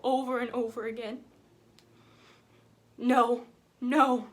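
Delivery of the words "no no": acted anguished "no", louder; lightly acted cry of disbelief; a father's grief, pushed out loud. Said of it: "I heard him crying and saying".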